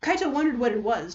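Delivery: ordinary delivery, nothing marked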